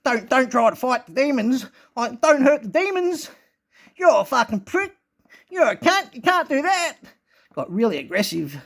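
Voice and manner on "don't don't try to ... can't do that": mock the spirit scolding, building to a shrill, high snarl; voiced entity. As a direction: hostile spirit voice getting more and more aggressive. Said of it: "It's like".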